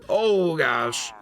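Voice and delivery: ordinary delivery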